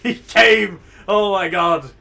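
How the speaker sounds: loud, high exclamation of disbelief; shocked outburst. Real, not acted. Streamer reacting to a game twist, shocked and loud.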